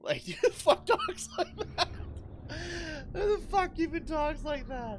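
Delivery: high and loud through real laughter; laughing disbelief. Real, not acted. Streamer laughing at silly game dialogue, high loud laugh. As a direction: crack up while asking in disbelief.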